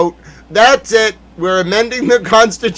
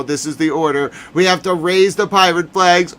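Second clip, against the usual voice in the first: ordinary delivery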